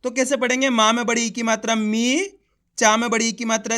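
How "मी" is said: मी with a long, stretched ee; drawn-out vowel. Shown as a demonstration. Teacher shows learners how to read the long ee, drawn-out मी. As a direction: hold the vowel in मी, letting it rise. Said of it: "खींच के पढ़ा जाता है".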